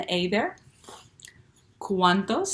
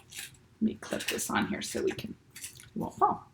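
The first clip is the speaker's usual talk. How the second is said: whispered aside; 11 semitones higher, 9 dB quieter than usual. Real, not acted. Teacher aside while fixing paper.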